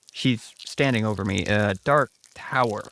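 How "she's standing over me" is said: ordinary delivery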